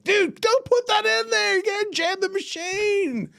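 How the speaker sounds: high, slow put-on scolding voice; mock yell at a customer. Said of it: "the same guy that would yell at a customer".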